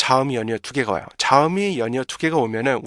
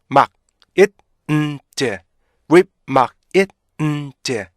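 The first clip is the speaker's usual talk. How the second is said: "whip, 막, it, 음, 제" as separate stopped beats; punchy syllable-by-syllable drill with gaps; five-beat breakdown; 4 dB louder, much slower than usual. Shown as a demonstration. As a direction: chant the pieces of remind one by one. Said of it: "총 다섯 개의 리듬으로".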